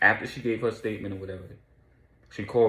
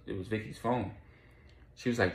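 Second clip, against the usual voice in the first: usual speaking voice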